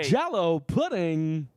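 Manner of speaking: slow, drawn-out Cosby voice; lightly voiced Bill Cosby; Cosby impression. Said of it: "Do your Cosby impression".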